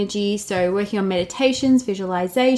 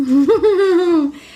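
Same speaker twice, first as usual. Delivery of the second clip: loud, high excited laugh; burst of laughter; 9 semitones higher, 6 dB louder than usual. Real, not acted.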